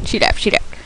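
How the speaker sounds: soft unvoiced whisper; hushed whisper. Real, not acted. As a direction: whisper it in relief. Whispering relief.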